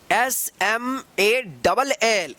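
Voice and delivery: very slow letter by letter एस एम ए डबल एल; stretched spelling, each letter apart. Shown as a demonstration. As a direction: spell it out slowly for the class. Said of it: "इसको स्मॉल मत पढ़िएगा".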